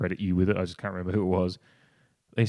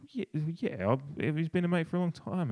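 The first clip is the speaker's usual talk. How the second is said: higher-pitched retold hedging; dancing around it; 9 semitones higher than usual. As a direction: imitate an evasive, reluctant answer. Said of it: "the way Sloane was dancing around".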